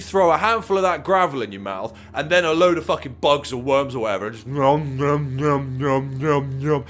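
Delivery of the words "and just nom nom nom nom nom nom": playful chomping "nom nom"; mock chewing nom sounds. Host acts out a platypus chewing gravel, playful nom sounds. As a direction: mime munching with your voice.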